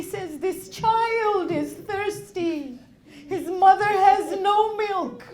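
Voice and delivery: loud, fervent sermon delivery; impassioned preaching. Acted. Preacher voicing Imam and mother at Karbala, loud. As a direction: push every line with grief.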